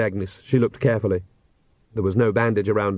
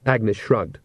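ordinary delivery

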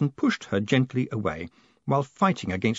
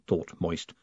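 usual speaking voice

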